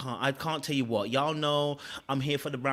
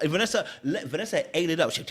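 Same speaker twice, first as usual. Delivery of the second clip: normal talking voice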